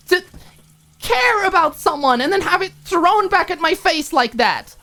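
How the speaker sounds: very high and loud, hurt fury; riled-up roommate confronting Alicia. Acted. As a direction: demand an answer, wounded. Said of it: "too riled up".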